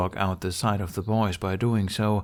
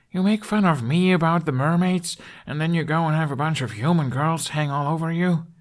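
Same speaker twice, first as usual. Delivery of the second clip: much higher and louder, jealous indignation; indignant protest; 8 semitones higher, 3 dB louder than usual. A narrator's reading. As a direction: protest it, high and loud, feeling wronged. Hiccup protests jealously at dragon.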